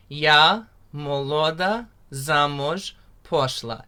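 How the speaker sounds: slow, carefully spoken "Yahodka u boru rosla" with rolled r; teacher modelling a Ukrainian line; slow Ukrainian demo. Shown as a demonstration. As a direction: spell out "Yahodka u boru rosla" slowly for learners. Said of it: "One more time".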